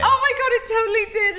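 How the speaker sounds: high, loud, laughing through the words; laughing with delight. Real, not acted. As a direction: burst out laughing with joy. Thrilled about meeting him.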